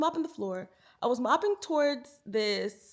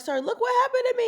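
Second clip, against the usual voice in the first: much higher, fast whine; whiny plea; 13 semitones higher, faster than usual. Retold or imitated. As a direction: whine it to your husband. Quoting herself to husband.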